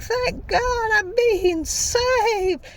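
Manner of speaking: very high, slow mock wail; hammed-up damsel crying out to be rescued; relieved victim. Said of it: "She notices truck, all like".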